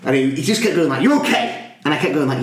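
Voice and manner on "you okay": sudden high-pitched mimicked question; mock intensity, pitched way up; over-intense check-in. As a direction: imitate an over-keen helper checking up. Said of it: "he was so intense".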